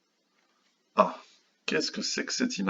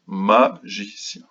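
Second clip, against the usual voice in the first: "Magicien" slow, with "Ma" hit hardest; stressed first syllable; 4 dB louder, much slower than usual. Shown as a demonstration. Teacher showing which syllable to colour, "Magicien" slow, first beat stressed. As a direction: punch "Ma", then separate gi and cien. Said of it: "la première".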